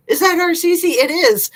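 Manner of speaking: light up at spotting her; delighted surprise. Real, not acted. Surprised to see old viewer.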